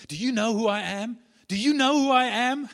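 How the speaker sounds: loud, high, outraged repeated question; indignant demand. Retold or imitated. Airport customer barks at counter lady, loud and indignant. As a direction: demand it like a self-important customer.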